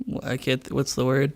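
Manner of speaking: normal talking voice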